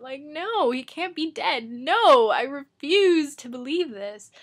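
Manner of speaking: hammed-up reader's denial at a death; retold denial. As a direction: refuse it, voice rising in mock protest. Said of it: "and I was like".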